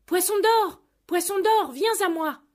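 high, loud call out to sea. Acted. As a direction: summon the fish across the water. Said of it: "et cria".

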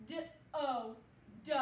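letters "D O" named, then blended into "do"; sounding out. Shown as a demonstration. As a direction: spell "D O", then say "do". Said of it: "Here we go".

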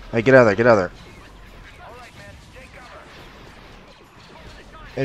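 urgent shout to retreat; shouting orders over battle. Real, not acted. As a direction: yell it across the battlefield.

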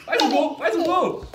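explosive goal commentary. Real, not acted. Urging the goal, commentary style.

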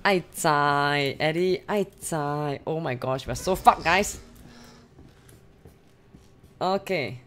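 ordinary delivery

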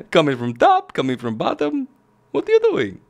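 mock Italian accent leaping high at the end; hammy cartoon Italian plumber accent; terrible Mario accent. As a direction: protest in a bad Mario accent. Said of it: "That's a terrible Mario accent".

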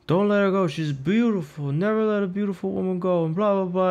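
high, earnest put-on vendor voice; imitating the ice cream man. Vlogger imitates the Turkish guy telling him to keep his girlfriend, high and earnest. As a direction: mimic a kindly man giving advice. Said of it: "He's like".

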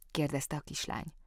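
plain reading voice

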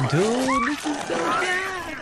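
high, drawn-out delighted gush; thrilled. Real, not acted. Fan watching epic battle, slow high delighted gush. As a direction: stretch it out, overwhelmed with joy.

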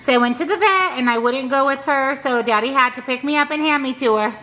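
high, bright dog's voice telling the story; voicing the dog. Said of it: "Palmer, can you tell everybody".

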